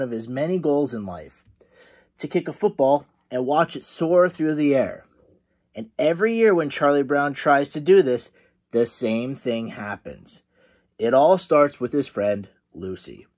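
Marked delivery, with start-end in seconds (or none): none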